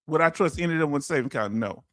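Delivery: fast rhetorical question, then a low flat no; quick rhetorical repeat. Real, not acted. Host quickly repeats the comment to viewers, brisk and rhetorical. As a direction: rattle it off as a question.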